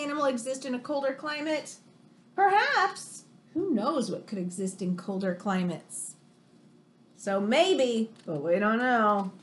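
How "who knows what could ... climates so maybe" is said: usual speaking voice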